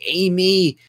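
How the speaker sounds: high, loud mock summons; mock boss calling an employee over, pitched high; imitating the boss. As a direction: call out like a boss botching a name. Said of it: "He's like".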